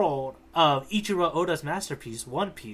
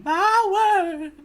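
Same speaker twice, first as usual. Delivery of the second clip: high, far-off Southern belle cry; a proper Southern lady, clutching pearls; 4 dB louder than usual. Retold or imitated. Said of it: "that one Southern lady in the East Blue".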